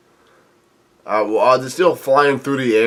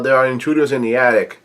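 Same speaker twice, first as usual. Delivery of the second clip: ordinary delivery, nothing marked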